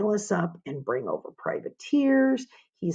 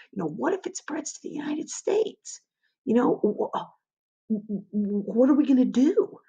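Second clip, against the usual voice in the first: higher, stammering mock panic; imitating panicked Americans; 6 semitones higher than usual. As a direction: act out worried citizens fretting. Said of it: "there is a lot of fear".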